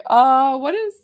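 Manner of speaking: high mock-alarmed question, starting loud; retold startled reaction. Healer laughingly retelling her scare, mock-startled and high. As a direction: imitate yourself being startled, pitch climbing high. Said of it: "I was like".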